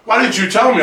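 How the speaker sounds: high, loud retold scolding; playful indignant reproach; mock reproachful dad. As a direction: demand an answer as an outraged dad. Dad's reproach acted in story.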